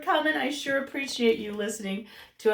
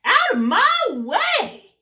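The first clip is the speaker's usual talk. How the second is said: goose shouting; 12 semitones higher, 8 dB louder than usual. Acted. Goose chasing birds off.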